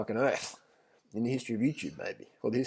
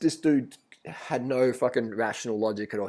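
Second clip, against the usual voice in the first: ordinary delivery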